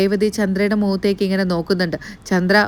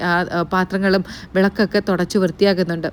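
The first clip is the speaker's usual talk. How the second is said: ordinary talk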